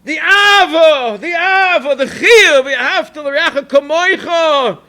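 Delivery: loud, rising sermon voice, driving each phrase home; fervent preaching. Acted. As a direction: preach unity with full force, slowing on key words. Rabbi preaches the moral.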